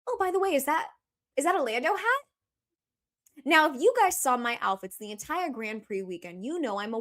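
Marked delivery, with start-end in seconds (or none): 0.1-2.2 high-pitched put-on questioning voice; high, rising mock inquiry; imitated guy. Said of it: "And then the guy goes".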